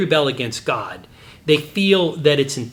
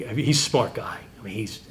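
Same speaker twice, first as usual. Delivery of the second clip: whispered aside; 3 semitones lower, 6 dB quieter than usual. Real, not acted. Still stunned, aside.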